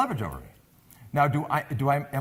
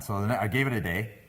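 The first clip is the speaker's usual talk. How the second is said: ordinary talk, nothing marked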